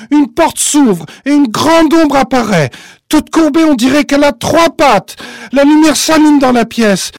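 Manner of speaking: high, loud suspense narration; tense storytelling. A narrator's reading. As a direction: keep it urgent and gripping.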